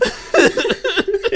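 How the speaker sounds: very high squealing laugh; a shrieking cackle of delight. Real, not acted. Laughing at fire extinguisher bash.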